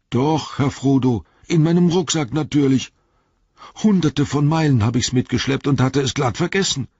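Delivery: high, excited call; aufgeregtes Rufen. A narrator's reading. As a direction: shout down with the good news. Sam calls down, excited about rope. (hoch und aufgeregt hinuntergerufen)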